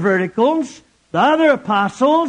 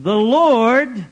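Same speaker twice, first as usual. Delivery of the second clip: usual speaking voice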